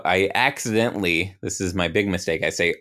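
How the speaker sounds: usual speaking voice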